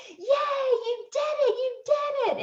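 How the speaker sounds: very high, drawn-out imitation of a cheering crowd. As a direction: squeal congratulations like far-off fans, without shouting. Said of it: "people yelling and clapping and, and just encouraging me".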